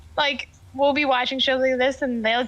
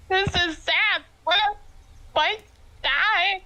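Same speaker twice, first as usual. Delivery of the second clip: higher, mock-tearful voice; a hammed-up teary husband; husband crying; 7 semitones higher, slower than usual. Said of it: "He's like".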